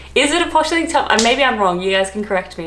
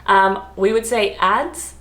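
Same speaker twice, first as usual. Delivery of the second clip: usual speaking voice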